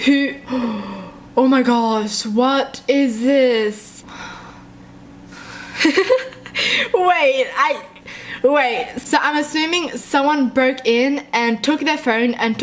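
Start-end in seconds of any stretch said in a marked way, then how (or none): 5.6-6.9 high nervous giggle; scared laughing burst. Real, not acted.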